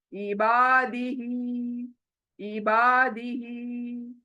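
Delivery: इबादिही with बा and ही each held long; drawn-out vowels. Shown as a demonstration. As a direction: lengthen बा and ही in इबादिही, slowly. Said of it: "बा को अलिफ मद्दा की वजह से". (इबादिही में बा और ही को खींचकर)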